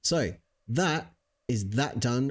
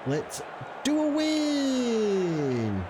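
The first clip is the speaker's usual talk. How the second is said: drawn-out rally cry; 11 semitones higher, much slower than usual. Pretend. Rallying the team.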